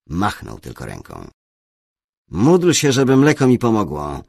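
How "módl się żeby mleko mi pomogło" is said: loud, high-pitched grumbling; gruff grumble. A narrator's reading. Bishop grumbling to his inquisitor about his ailment, gruffly. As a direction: grumble it out loudly. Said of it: "burknął".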